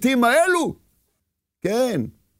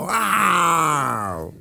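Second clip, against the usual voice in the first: drawn-out lion roar; 7 semitones lower, much slower than usual. Acted. Lion king appears.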